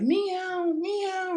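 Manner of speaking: very high, thin held meows; cat meowing. Retold or imitated. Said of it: "he like".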